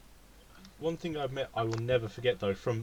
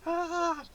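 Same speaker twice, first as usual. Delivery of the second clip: high-pitched scream. Retold or imitated. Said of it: "like, high pitch".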